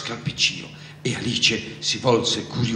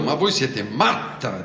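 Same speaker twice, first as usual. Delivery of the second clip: usual speaking voice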